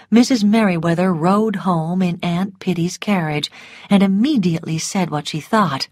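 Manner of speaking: usual speaking voice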